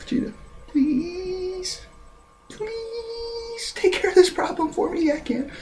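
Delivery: tiny high falsetto plea, drawn out; hammed-up desperate whimpering plea; mock begging. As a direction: plead pathetically in a soft, squeaky voice. Voices Hercule begging Vegeta.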